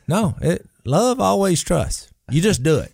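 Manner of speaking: pushing the point hard in an argument, pitch way up; heated insisting. Retold or imitated. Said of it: "we were arguing about it".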